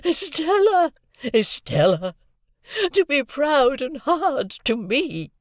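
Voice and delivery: very high, loud anguished shriek. A narrator's reading. Havisham shrieking at Estella with arms outstretched. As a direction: scream her name in despair. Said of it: "quite shrieked".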